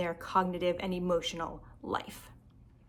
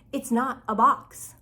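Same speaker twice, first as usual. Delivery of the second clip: "not a box" slow, higher and louder; storybook insistence with each word set apart; insistent denial; 4 semitones higher, 6 dB louder, slower than usual. Acted. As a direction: punch "not" in a slow, firm correction. Reads the rabbit's insistent denial.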